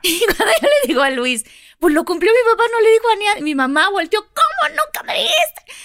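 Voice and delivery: hammed-up shocked mother; laughing, imitating her mother's outraged surprise; mock indignant surprise. Retells surprised mom, laughing.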